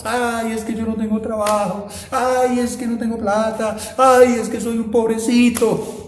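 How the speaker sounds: hammed-up poor-me whine; mock lament. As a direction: imitate a believer pitying himself. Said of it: "nos lamentamos".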